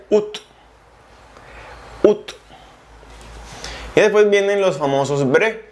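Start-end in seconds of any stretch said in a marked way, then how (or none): 0.1-2.4 août said just oo; août, one short oo sound; août à la française; French pronunciation. Shown as a demonstration. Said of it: "se pronuncia".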